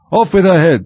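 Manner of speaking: high, loud royal command; imperious yell; full-voice shout. A narrator's reading. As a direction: shout the sentence like a furious queen. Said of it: "shouted at the top of her voice".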